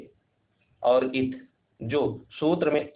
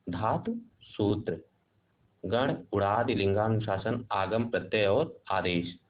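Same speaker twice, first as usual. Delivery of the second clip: slow, softer, lower list of terms; slow, quiet dictation of grammar terms; 4 semitones lower, 4 dB quieter than usual. Shown as a demonstration. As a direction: spell the list out slowly for students to write. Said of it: "teacher repeats the list for dictation".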